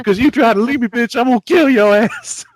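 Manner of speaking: high, loud mock threat; hammed-up furious boyfriend; imitated angry threat. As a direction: blow up suddenly, high and loud. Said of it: "that bit Richard Pryor had".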